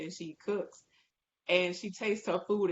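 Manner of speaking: ordinary talk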